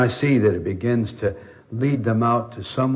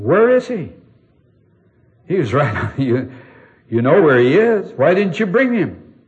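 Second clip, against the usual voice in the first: indignant demand; 5 semitones higher, 6 dB louder than usual. Retold or imitated. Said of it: "imagine how these chief priests felt".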